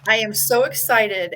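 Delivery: usual speaking voice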